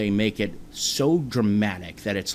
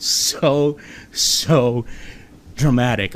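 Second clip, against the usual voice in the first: "so, so dramatic" with each SO drawn out and loud; emphatic stress on SO; 3 semitones higher, 5 dB louder, much slower than usual. Shown as a demonstration. Host describing British news reports, heavy emphasis on "so" and "dramatic". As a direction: lean hard on both words "so", pausing before "dramatic".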